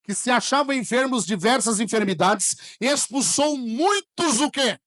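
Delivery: loud, slow, emphatic preaching; preaching at full force. Acted. Pastor reading scripture to the church, loud and slow. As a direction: proclaim the verse to the church.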